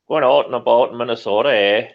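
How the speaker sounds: put-on fast, loud Minnesota accent with rounded out and about; mock Minnesota accent. Joking that Minnesotans sound Canadian, mock Minnesota accent. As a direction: ham up the Minnesota vowels. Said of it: "Canada light".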